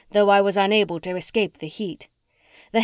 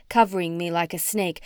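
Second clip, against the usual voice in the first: plain reading voice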